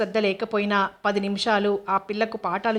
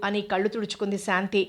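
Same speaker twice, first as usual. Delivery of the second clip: ordinary delivery, nothing marked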